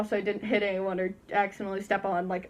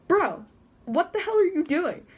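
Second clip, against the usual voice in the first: exasperated bro; 7 semitones higher than usual. Retold or imitated. Confronting Dave.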